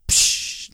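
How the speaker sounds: imitated splash noise, unvoiced breath of air; whooshed "psh". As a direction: make a short rushing water sound. Imitates plunge into pool.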